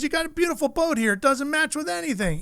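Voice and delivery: high-pitched imitation of an objecting guest; John Force. Guest objects to the lamp on the beautiful boat, emphatic. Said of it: "He goes".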